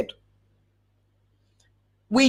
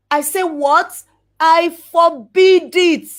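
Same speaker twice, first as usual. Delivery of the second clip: emphatic forbid; 6 semitones higher, 6 dB louder, slower than usual. Real, not acted. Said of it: "emphatic preaching".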